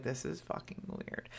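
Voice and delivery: soft, hushed aside; imitated unnerved parents. Parents hearing the twins talk about Hexham, hushed imitated reaction. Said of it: "the parents are like".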